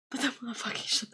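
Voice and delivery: unvoiced, conspiratorial whisper. Real, not acted. Vlogger whispering about German insults, hushed. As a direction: whisper it like a secret.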